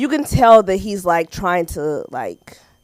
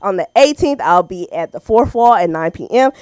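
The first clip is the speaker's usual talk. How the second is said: normal talking voice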